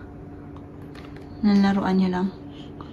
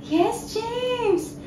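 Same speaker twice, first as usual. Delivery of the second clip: high praise; 9 semitones higher, 6 dB quieter than usual. Real, not acted. Praising the baby.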